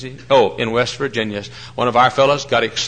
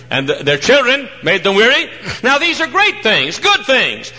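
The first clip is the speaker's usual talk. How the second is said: preaching at full force; 11 semitones higher, 3 dB louder than usual. Acted. Preacher praising great works to his hearers, preaching at full force. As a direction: lean hard into every word.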